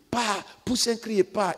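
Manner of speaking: a hammed-up exorcist barking orders; mimicking exorcist. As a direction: parody the copycat deliverance minister. Imitating exorcists at Daloa.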